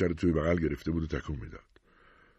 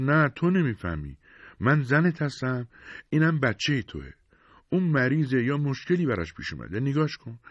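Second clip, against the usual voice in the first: heated pleading; 7 semitones higher than usual. Acted. Wife confronts husband over sick baby.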